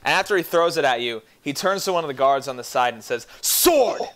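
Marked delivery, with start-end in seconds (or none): 3.4-4.0 high, sharp call for a sword; barked command. Acted. Villain calling to his guard for a sword. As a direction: snap the order at the guard.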